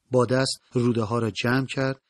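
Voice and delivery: ordinary delivery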